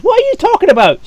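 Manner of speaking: high, loud indignant outburst; angry guard. Acted. Guard snaps at his partner in sudden outrage, loud and high. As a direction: snap at the other guard. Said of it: "The other guy goes".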